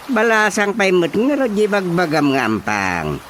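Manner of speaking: normal talking voice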